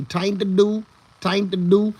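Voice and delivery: high, loud mock Indian accent, repeating the phrase; imitating Indian accent. Recalling an Indian neighbor's catchphrase, high mock Indian accent. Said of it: "and he would always say".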